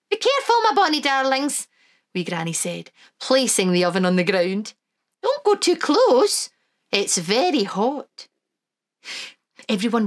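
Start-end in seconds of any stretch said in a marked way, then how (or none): 0.1-8.3 put-on high, lilting old Scottish granny; Wee Granny voice. Granny to the children, high and fond.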